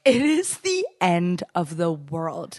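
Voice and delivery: very slow, drawn-out emphasis; mock-dramatic pronouncement. Real, not acted. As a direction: stretch every word for comic doom. Mock-solemn pronouncement about men.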